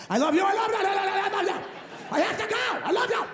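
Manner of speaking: high, rapid mom goodbyes; imitating mom. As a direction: rattle off a mother's endless goodbyes. Mother's endless phone goodbyes.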